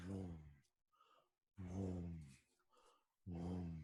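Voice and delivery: low, soft humming vrooms, spaced apart; pulsing vroom. Acted. Game master describing a glowing, pulsing cube, soft low vrooms. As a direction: imitate a slow low pulse of energy.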